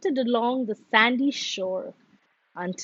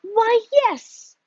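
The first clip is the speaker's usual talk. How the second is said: surprised; 10 semitones higher, 5 dB louder than usual. A narrator's reading. Bear answers frog.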